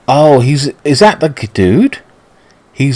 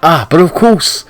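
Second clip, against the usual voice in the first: florid English gentleman, pitched up; grand stagey lover's speech; posh suitor; 6 semitones higher than usual. Acted. Suitor explains his return to his beloved, high and posh.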